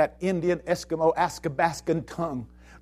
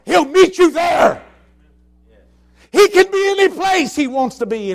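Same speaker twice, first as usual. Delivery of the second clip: loud, high shouted preaching; preaching at full force; 9 semitones higher, 9 dB louder, slower than usual. Acted. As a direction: thunder it out to the congregation. Preaches Jesus meets you.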